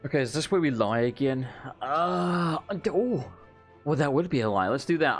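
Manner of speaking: ordinary delivery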